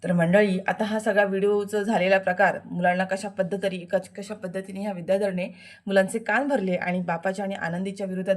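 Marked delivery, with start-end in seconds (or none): none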